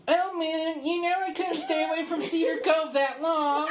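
thin, pinched high voice. Retold or imitated. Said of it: "says in this thin, pinched voice".

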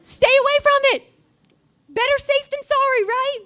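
fast, high, loud preacher shout; shouted warning to the youth group; preaching shout. Real, not acted. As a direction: belt it to the congregation. Preacher repeats warning at full force.

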